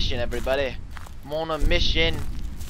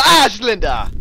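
loud, higher cry of alarm; startled yell; 4 semitones higher, 9 dB louder than usual. Real, not acted. Player surprised by Slender while picking up paper, startled yell. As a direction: yelp it out in sudden fright.

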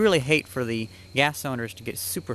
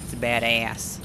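badass with a broad Boston accent; 3 semitones lower, much slower than usual. Shown as a demonstration. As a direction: drop the r, Boston style. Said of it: "you have to say with a Boston accent".

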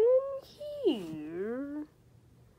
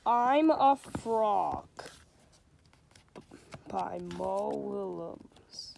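slow storybook reading with light character voices; reading to children; slower than usual. A narrator's reading. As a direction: read it like a bedtime picture book. Reads a picture book aloud.

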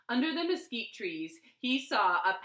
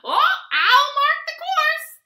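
very high, loud bird squawk; squawking roadrunner; 13 dB louder than usual. A narrator's reading. Roadrunner offers to mark the race course, loud squawk. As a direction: squawk it out at the top of your range. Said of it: "squawked Roadrunner".

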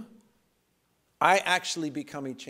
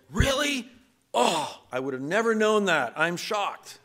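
mock shock; 7 semitones higher, 4 dB louder, slower than usual. Sarcastic retort voiced in story.

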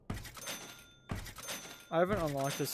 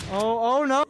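alarmed shout; 5 semitones higher, 7 dB louder than usual. Real, not acted. Wall breaking.

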